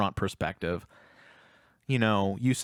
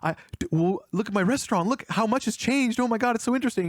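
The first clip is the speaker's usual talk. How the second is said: high, gushing starstruck babble; imitating a flustered, awestruck fan; stammering super fan; 9 semitones higher, faster than usual. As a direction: stumble over words, then gush higher and higher. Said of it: "kinda stammering, but he's like, he's clearly a super fan".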